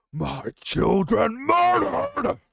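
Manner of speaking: a dragon screaming out his grief; grieving dragon roar. Acted. Deathwing roars at the egg-smashers, anguished. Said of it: "Along with anger was a deep grief".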